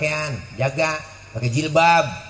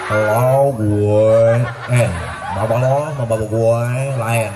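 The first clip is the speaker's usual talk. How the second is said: louder mock-cool slang; alay teen talk; 4 semitones lower, 4 dB louder, slower than usual. Preacher mocks alay teens, a hint of slangy teen. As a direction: toss it off like a trendy teen. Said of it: "Ngomongnya udah so alay".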